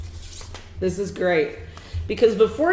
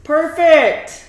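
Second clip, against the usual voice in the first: excited praise; 7 semitones higher, 8 dB louder, much slower than usual. Real, not acted. Praising the child's reading.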